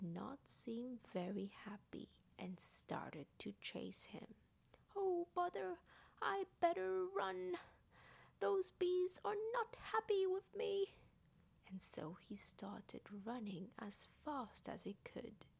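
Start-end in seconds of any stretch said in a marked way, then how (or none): 5.0-10.9 high-pitched, worried storybook bear; high, dismayed cartoonish voice; bothered bear character. Voices Pooh chased by bees.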